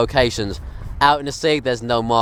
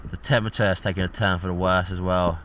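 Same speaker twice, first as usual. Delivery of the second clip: softer, low, drained voice; seasick and weak; 5 semitones lower, 9 dB quieter than usual. Real, not acted. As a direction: speak quietly, worn out. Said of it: "I feel absolutely terrible".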